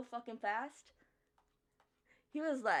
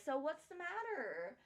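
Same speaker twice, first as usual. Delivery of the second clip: high, polite manager voice; sweet customer service tone; 8 semitones higher, 3 dB quieter than usual. Retold or imitated. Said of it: "he's like".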